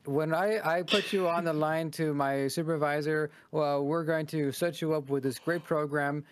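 a hint of a smooth phone salesman making promises; pushy telemarketer. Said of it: "So it was like".

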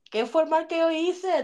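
slow, high mock-innocent whine; meme quote. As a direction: play dumb in denial, stretched out. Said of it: "como dice el meme".

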